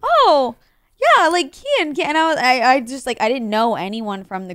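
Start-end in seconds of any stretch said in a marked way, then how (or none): 0.0-2.0 high, louder mock excitement; loud excited recognition. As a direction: gush it like you know him well. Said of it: "And you're like".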